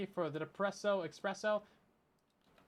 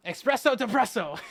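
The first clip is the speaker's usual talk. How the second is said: incanting it like a wizard's spell, a bit louder; spell casting; 4 semitones higher, 6 dB louder than usual. Pretend. Streamer mock-casting a pet name as a spell. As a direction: cast it like a Harry Potter spell. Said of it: "It sounds like a Harry Potter spell".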